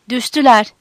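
shouting. A narrator's reading. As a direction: someone shouting news to comrades. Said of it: "diye bağırdı birisi".

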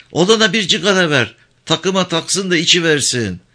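put-on high, brisk order from an old mother; mild angry call. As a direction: snap at the father, hammed up only lightly. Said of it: "hışımla seslendi".

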